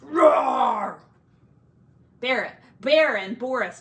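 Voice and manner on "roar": loud roaring word; scary roar. A narrator's reading. A monster in the cave roars at the animals, loud roar. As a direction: roar it out to scare them. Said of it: "a very scary roar".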